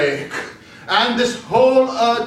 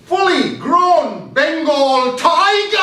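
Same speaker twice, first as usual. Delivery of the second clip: slow, louder and higher on the reveal; excited reveal; 9 semitones higher, 6 dB louder, slower than usual. Acted. Speaker reveals the tiger to the audience, slow excited build. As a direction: land the surprise big.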